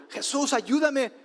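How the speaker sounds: high, slowed cry for help; desperate plea. A narrator's reading. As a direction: plead like a desperate mother. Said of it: "esta mujer desesperada le dijo". (súplica desesperada y aguda)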